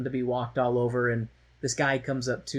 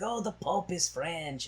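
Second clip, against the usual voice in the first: mocking Frenchman; 5 semitones higher, 4 dB quieter, slower than usual. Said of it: "they're like".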